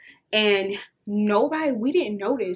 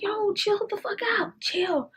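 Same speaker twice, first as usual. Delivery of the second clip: urgent hushing; 11 semitones higher, slower than usual. Retold or imitated. Hushing friend making a scene.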